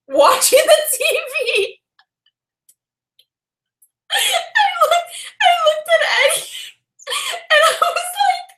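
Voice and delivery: giggling through the words, high; breaking up with laughter mid-sentence; laughing. Real, not acted. Telling the awkward house visit, laughing helplessly.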